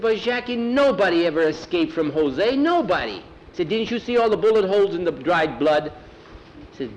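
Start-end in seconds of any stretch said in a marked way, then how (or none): 0.0-3.2 high, urgent insistence; alarmed warning. Acted. Juan warning Jackie nobody escapes Jose, alarmed. 3.7-5.9 high, insistent challenge; alarmed warning. Acted. Juan reminding Jackie of the bullet holes, alarmed. Juan warns of deadly escape attempts.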